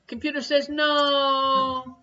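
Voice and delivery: slow, drawn-out deadpan refusal; comedy catchphrase. Acted. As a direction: stretch it out like a catchphrase. Well-known comedy catchphrase, drawn out.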